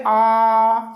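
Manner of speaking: a long, fully open आ with the mouth wide. Shown as a demonstration. Teacher showing students the open vowel आ. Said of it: "आ बोलिए".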